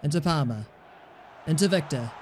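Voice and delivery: normal talking voice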